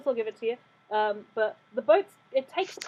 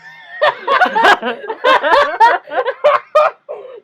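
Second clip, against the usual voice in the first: high, loud, long cackling laugh; loud burst of laughter; 10 semitones higher, 11 dB louder than usual. Real, not acted. Laughter after the shouted order.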